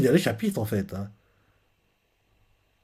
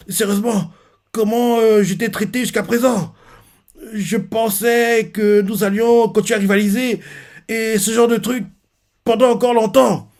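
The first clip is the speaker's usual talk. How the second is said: crying rival; 7 semitones higher than usual. Retold or imitated. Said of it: "Kachan pleure".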